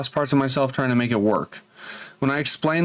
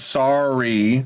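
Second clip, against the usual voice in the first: long, stretched sorry with a rising end; drawn-out apology. Retold or imitated. Boyfriend texting his girlfriend an apology, drawn out. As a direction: drag out the apology, lifting at the end.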